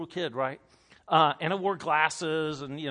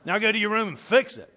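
louder, higher pretend parental order; mock cruel parent; 5 semitones higher, 8 dB louder than usual. Imagined parent dismissing a crying child, mock-stern and loud. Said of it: "And then for you to go".

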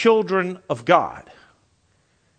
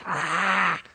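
imitated cougar snarl, a long "ah"; cougar roar; 4 dB quieter than usual. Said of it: "he does his".

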